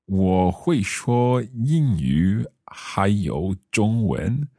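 slow, careful learner Mandarin; careful Chinese accent. Acted. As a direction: speak each syllable slowly, role-playing. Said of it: "my best Chinese accent".

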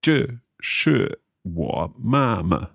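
这是我妈妈 said slowly, syllable by syllable; slow careful repeat. Shown as a demonstration. Learner practising the sentence.

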